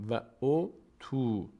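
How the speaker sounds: "و او تو" read slowly, u kept short; recitation reading. Shown as a demonstration. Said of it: "اینو نکشید".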